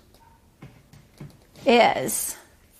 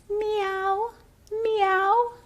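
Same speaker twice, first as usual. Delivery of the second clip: high, soft mewing meow; high cat voice for a child; 9 semitones higher, 4 dB quieter than usual. Retold or imitated. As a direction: mew like a little kitten. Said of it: "she says".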